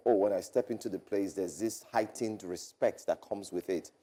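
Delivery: ordinary talk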